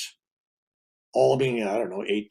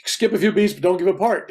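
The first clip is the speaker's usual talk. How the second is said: urging; 6 semitones higher, 5 dB louder than usual. Retold or imitated. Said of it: "He goes".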